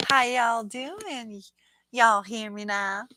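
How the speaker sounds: slow, drawn-out deep South accent. Shown as a demonstration. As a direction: stretch it out like a Southern greeting. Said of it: "can you say in your best deep South accent".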